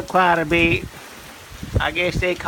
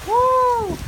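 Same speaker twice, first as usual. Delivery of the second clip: high, thrilled whoop at the gust; excited whoop. Real, not acted. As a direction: let out a wild high woo. Strong gust hits while filming.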